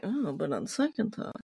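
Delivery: sly Polidori. Retold or imitated. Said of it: "He's like".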